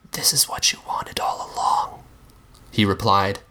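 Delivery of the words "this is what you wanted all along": a flat, breathy whisper, higher; hushed, flat whisper. A narrator's reading. The Monster hushing the narrator, whispered and flat. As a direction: hush it out with no emotion at all. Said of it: "His voice was always hushed and flat".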